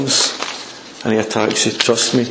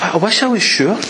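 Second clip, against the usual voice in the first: higher, yearning admission; acted wish for certainty, pitched up; wishful doubt; 6 semitones higher than usual. Believer unsure God will help, wishful doubt. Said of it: "never experienced that".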